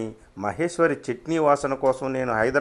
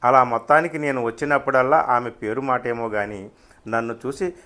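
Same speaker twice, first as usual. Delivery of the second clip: ordinary delivery, nothing marked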